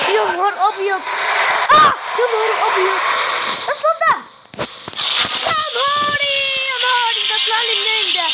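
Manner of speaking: high-pitched alarmed cries; startled panic. Real, not acted. Player scared by something popping out, startled panic. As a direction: cry out as if ambushed. Jump scare in horror game.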